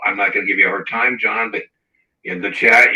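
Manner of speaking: ordinary talk, nothing marked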